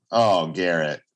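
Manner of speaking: ordinary delivery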